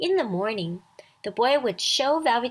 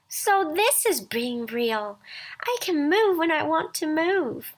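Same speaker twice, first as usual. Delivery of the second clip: delighted rabbit; 7 semitones higher than usual. A narrator's reading.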